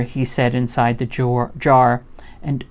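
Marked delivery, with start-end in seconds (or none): none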